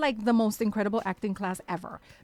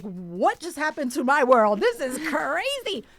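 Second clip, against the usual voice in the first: loud, slow, stretched-out disbelief; hammed-up astonishment, rising in pitch; retold amazement; 4 semitones higher, 6 dB louder, slower than usual. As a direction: marvel dramatically, drawing out the words. Retells her amazement.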